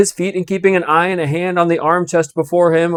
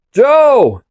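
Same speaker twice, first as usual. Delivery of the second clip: a sharp high call across the coach; 11 semitones higher, 7 dB louder than usual. Acted. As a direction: call his name loud up to the guard. Coachman calls to the guard.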